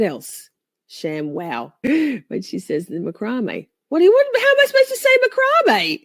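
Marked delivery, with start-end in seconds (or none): 3.9-6.0 high, loud, fast mock outrage; indignant outburst. Real, not acted. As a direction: squeal in mock offence at the teasing. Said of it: "how dare you".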